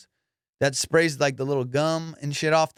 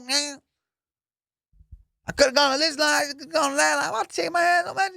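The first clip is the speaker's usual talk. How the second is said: very high put-on voice, venting hard; hammed-up outraged rant; mock furious guard. Security guard venting to the narrator, high mock fury. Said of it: "He was fucking pissed".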